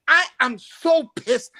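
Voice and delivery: slowed, dragged-out angry statement; slow fuming complaint. Real, not acted. Caller telling the show host how upset he is, slow and fuming. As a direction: stretch each word out in disgust. Fan venting after Liverpool loss.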